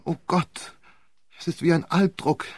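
high, loud, frantic denials; on the edge of collapse; accused man close to a nervous breakdown; near breakdown. A narrator's reading. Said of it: "Einem Nervenzusammenbruch nahe".

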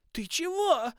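very high, loud indignant roar. A narrator's reading. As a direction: bellow it in outrage. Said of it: "взревела Берта".

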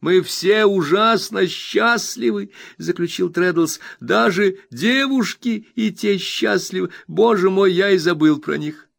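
high, bright-eyed friend overjoyed; eager Traddles. Acted. Traddles reassures his old friend Copperfield, eager high voice. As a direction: gush with happiness at the reunion.

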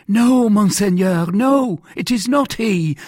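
high, loud, frantic denial; cried out. A narrator's reading. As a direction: protest desperately to your interrogator. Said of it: "cried Bonacieux".

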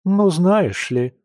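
slow, knowing condescension; condescending. Acted. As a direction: talk down to her with a drawl. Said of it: "снисходительно ответила Оля".

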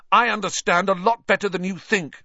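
fast, loud, high and trembling; shaking with rage. A narrator's reading. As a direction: spit it out, voice shaking. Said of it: "his voice shook more than ever".

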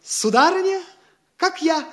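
put-on high-pitched courting of a young lady; gallant suitor. Daydreamer approaches a landowner's pretty daughter, affected gallant address. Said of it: "К дочечке какой-нибудь хорошенькой подойдёшь".

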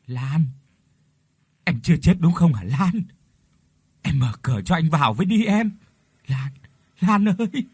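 pleading madman. A narrator's reading. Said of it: "Thanh âm bây giờ nhỏ nhẹ hơn".

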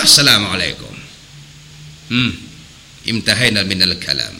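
imitating the strict guru deeply; stern old teacher. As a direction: intone it like a severe religious master.

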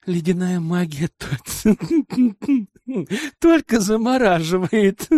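words broken up by a burst of laughing; talking through laughter. A narrator's reading. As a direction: try to get the sentence out while laughing. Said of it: "сквозь смех ответил я".

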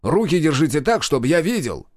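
shouted command. A narrator's reading. Major orders hands kept visible, shouting. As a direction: call out the order firmly. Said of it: "крикнул Комаров".

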